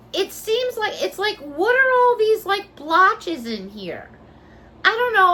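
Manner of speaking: high, loud picky complaint; whiny disbelief at the flaws; exasperated. Real, not acted. Complaining about blotches.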